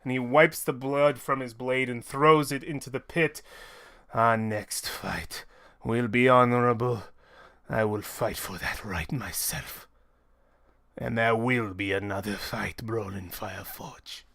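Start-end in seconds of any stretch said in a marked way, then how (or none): 4.1-14.2 low, soft grave vow; solemn fighter. Acted. Fighter promises his rival an honorable rematch, low and solemn. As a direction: promise it deep and quiet.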